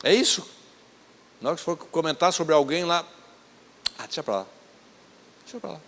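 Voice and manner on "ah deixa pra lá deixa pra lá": softly muttered to oneself; quiet self-talk. Pretend. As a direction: let it go under your breath. Inner thought, stopping gossip.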